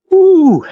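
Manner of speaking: a high, loud mock ooh of admiration; mock admiring ooh. Gawking at the orange Lamborghini, a high mock ooh. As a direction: coo over the car in mock awe.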